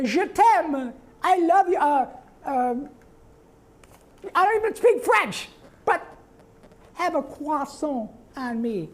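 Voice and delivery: very high, loud cartoon kid with fake French; Carl attempting French. Carl trying French on someone, high and goofy. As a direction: fumble the French like a nerd showing off. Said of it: "Carl was probably learning French".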